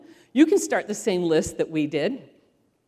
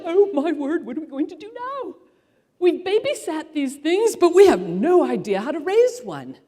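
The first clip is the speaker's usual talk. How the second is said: new-parent panic; 7 semitones higher, 6 dB louder than usual. Retold or imitated. New parents panicking over baby.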